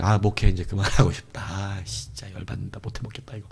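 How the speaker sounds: low, trailing-off weary grumble; demotivated. Acted. Pastor imitating a burned-out minister wanting to quit, demotivated. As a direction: sink into a quiet, worn-out mumble. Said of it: "아, 의욕을 상실하게".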